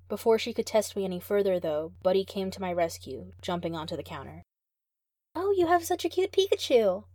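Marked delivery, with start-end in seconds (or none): 5.4-7.0 high, loud squeal over a cute Pikachu. Acted. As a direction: gush at the Pikachu like a fan.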